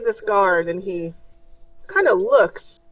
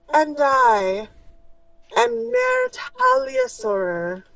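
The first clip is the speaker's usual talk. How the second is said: much higher, slow and lofty; proud noblewoman; slower than usual. Acted. Noble introducing herself to the prisoners, slow and proud. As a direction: announce your name with lifted chin. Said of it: "raises her chin up proudly".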